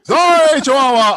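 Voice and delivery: high, loud apology to a dog. Real, not acted. Host apologizing loudly to a viewer's scared chihuahua. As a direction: call out sorry to the pup.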